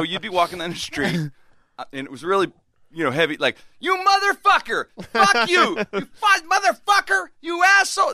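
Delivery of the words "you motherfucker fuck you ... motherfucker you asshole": high, loud ranting yell; screaming neighbor. Retold or imitated. Crazy neighbor swearing out his window at nobody, screaming. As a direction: scream curses like a crazed man. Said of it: "This guy's just screaming at his window".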